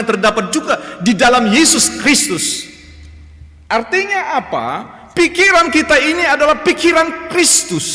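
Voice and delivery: high, loud impassioned sermon; full-force preaching. Acted. Preacher urging congregation to fill minds with Christ, impassioned and loud. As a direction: preach it with fire.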